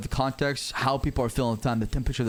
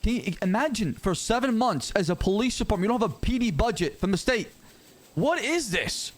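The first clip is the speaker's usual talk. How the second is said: incredulous rant; 7 semitones higher than usual. Acted. Officer ranting about unpaid police.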